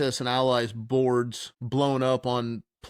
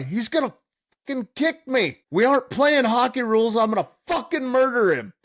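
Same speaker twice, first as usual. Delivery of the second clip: high, loud hammed-up rant; mock drunken rant; 10 semitones higher, 4 dB louder than usual. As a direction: rant like a furious drunk friend. Quoting friend's drunken rant.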